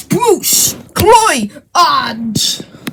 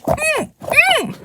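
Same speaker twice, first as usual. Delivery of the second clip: high puppet hum; 10 semitones higher, 8 dB quieter than usual. Acted. Puppet answers with hums.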